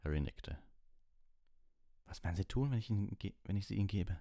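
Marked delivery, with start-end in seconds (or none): 2.1-4.2 tense question. Acted. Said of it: "fragte Ron angespannt".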